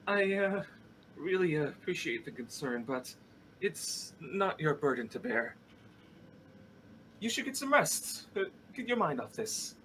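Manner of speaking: lightly voiced brush-off. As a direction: wave off the concern with a light strained voice. Wounded fighter brushing off concern.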